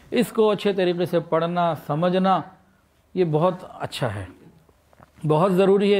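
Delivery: normal talking voice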